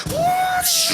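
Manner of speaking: very high, loud imitated rush; speeding whoosh. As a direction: make a loud whooshing getaway noise. Imitates man speeding down road.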